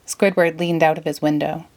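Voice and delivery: ordinary delivery, nothing marked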